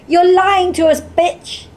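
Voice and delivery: high, loud accusing cry; crowd shouting. A narrator's reading. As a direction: hurl it as a shouted insult. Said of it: "they shout".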